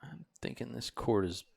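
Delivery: very low, soft undertone; barely voiced low murmur; hushed aside. Real, not acted. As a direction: say it under your breath, off to your booth partner. Muttered aside about a bad cord.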